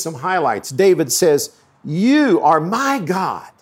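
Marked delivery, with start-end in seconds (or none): 1.8-3.5 slow, deliberate line leaning hard on "my"; stress on my. Shown as a demonstration. Preacher quoting David to the congregation, stress on "my". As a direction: draw the words out and punch "my". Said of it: "Emphasis on the word my".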